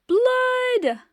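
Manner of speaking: very high, loud cry; shrieked alarm. Acted. Mother yelling at the sight of blood, very high and loud. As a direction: scream it in alarm.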